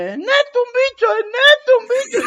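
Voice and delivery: high, loud mock shriek; a wife screaming at a creature in the house, high mock shriek; imitating her screaming. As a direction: shriek like a wife who spotted a bat. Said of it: "ella gritándome".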